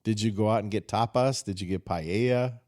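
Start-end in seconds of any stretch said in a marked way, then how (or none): none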